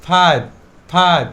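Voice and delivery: loud, deliberate "ফাইভ" with an airy F. Shown as a demonstration. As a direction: push a little air through the F of "ফাইভ". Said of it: "উচ্চারণ করবো একটু বাতাস বের করে".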